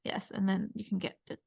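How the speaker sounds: mock ad-read murmur; fading whisper. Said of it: "his voice fades off to a whisper".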